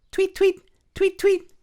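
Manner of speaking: very high, loud chirps; piping birdsong tweets; tweeting birds. Acted. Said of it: "went the birds".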